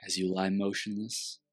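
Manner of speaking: guided meditation. Acted.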